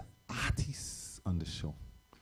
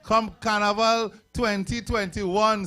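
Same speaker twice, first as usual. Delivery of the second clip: hype call; 7 semitones higher, 6 dB louder than usual. Real, not acted. MC hypes crowd on stage.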